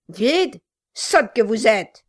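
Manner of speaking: loud, contemptuous dwarf; gruff gravelly dwarf voice. A narrator's reading. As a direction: sneer at her, loud. Dwarf insults wife.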